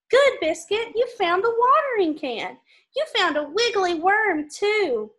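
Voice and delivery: lively storybook read-aloud; reading to children. Acted. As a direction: read it brightly for little kids. Reading picture book to kids.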